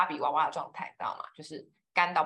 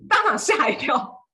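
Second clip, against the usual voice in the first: shocked; 8 semitones higher, 9 dB louder, slower than usual. Real, not acted. Shocked at the price.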